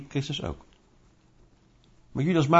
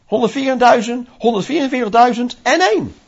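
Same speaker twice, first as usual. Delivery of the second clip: pleading to be included; 5 semitones higher, 3 dB louder than usual. Pretend. Believer begs to be included.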